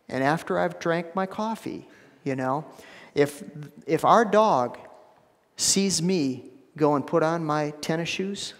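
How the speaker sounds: normal talking voice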